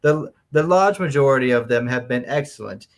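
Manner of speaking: mannered, British-sounding fifties letter writer; nineteen-fifties voice. Acted. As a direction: read it out in your best old-time fifties voice. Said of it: "in your best nineteen fifties voice".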